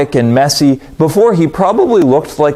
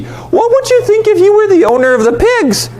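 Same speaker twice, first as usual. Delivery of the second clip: high animated rhetorical question. Real, not acted. Preacher asking the audience, high animated question. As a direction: pull the listeners in, voice up high.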